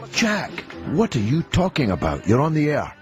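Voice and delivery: normal talking voice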